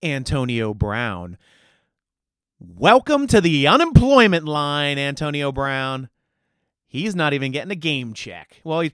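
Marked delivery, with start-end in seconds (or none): 2.6-6.1 loud, slow, gloating announcement; mocking shout. Real, not acted. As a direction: boom it out like a sarcastic host. Mocking a released player.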